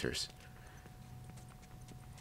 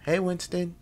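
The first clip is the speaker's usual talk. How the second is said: lightly voiced girl's greeting, pitched higher; a hint of Ellie, lifted well above usual pitch; imagined Ellie greeting; 12 semitones higher, 3 dB louder than usual. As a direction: read her imagined hello in a higher voice. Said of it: "what the hell would I say to her".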